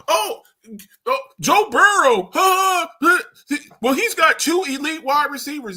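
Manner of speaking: mock a fan butting in with an objection; hammed-up high protest; mocking objector. Mimics fans objecting about Joe Burrow.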